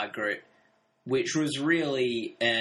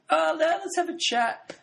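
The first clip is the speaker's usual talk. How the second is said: much higher, sugary mock-polite; Margaret quoted; 14 semitones higher than usual. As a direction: play sweetly insistent Margaret. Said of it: "she's like".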